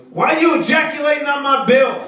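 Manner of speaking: high, loud outraged caller; furious caller. Retold or imitated. Angry caller yells at phone rep about bill. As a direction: yell it like a wronged customer.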